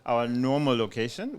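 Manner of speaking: normal talking voice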